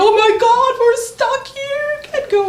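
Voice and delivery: mock panic. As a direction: play up how scared you were, shrieking. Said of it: "I was freaking out".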